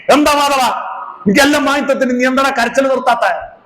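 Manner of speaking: high-pitched indignant telling-off; acted scolding. Companion losing patience with a crying child, mock angry scolding. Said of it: "ആൾ തട്ടിക്കയറി".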